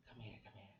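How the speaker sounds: very soft, low-key "come here"; beckoning someone over. Acted. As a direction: beckon them quietly, almost under your breath.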